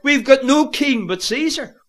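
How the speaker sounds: high, loud cry of protest; crowd crying out. Acted. The people answering Pilate before the prisoner, crying out. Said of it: "The people cry out".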